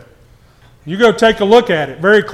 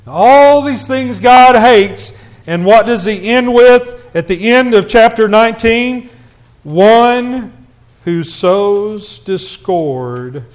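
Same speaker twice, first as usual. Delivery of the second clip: raised, loud preacher's voice; emphatic preaching; 4 semitones higher, 5 dB louder, slower than usual. Acted. Preacher listing what God hates, emphatic and loud. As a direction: drive it out to the congregation.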